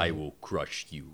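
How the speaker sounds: low menacing crime boss; Kingpin voice. Pretend. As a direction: threaten low and cold. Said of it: "my Kingpin is better than yours".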